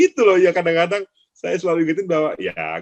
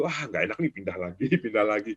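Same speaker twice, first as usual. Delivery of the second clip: softer, lower mock grumble; a hint of a fickle trader, softer and lower; fickle trader muttering; 6 semitones lower, 7 dB quieter than usual. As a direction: play the restless stock-hopper, softer. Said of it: "Nggak usah nunggu".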